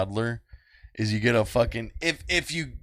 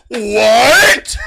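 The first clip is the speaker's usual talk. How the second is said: high, loud disbelief; outraged mock shout; 10 semitones higher, 10 dB louder than usual. Little girl hearing he doesn't care, shouted imitated outrage. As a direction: blurt it loud and high, rising.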